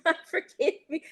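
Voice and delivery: laughing mock plea. Mock teenage prayer at altar.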